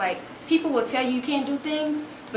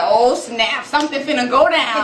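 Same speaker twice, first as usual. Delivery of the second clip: loud, raised-pitch mock alarm; shocked thrill; 3 semitones higher, 6 dB louder than usual. Teacher watching students face the boss, shocked thrill. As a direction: announce trouble brewing, loud. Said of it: "So I was another culture shock".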